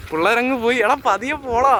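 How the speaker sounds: high call to slow down. Real, not acted. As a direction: shout after friends riding ahead. Calling to friends ahead.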